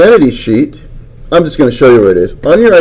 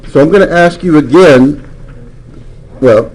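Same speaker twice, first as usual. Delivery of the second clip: usual speaking voice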